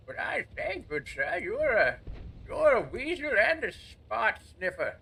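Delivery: put-on high-pitched hammy British old-man voice, swooping; mock British old man. Gamer insulting an enemy as "good sir", mock British old-man voice. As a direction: put on a bad British accent like an old wizard. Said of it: "I'm mixing an old man voice with my British accent".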